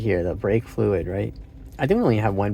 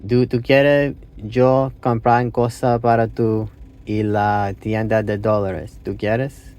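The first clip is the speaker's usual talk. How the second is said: slow, word-by-word learner's Spanish; broken Spanish; slower than usual. Real, not acted. Learner offering to buy someone something, slow broken Spanish. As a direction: piece the question together in halting Spanish. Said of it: "in my broken Spanish".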